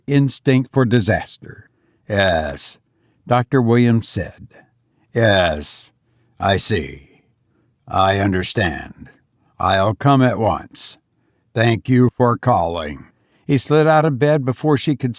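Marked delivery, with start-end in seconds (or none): 2.1-2.8 grave husband voice. Acted. Father takes bad news. 5.1-13.1 low, steady phone reply; grave husband voice. Acted.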